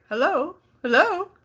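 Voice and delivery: high questioning "anybody there" call; searching, rising hello. Real, not acted. Calling out to absent viewers.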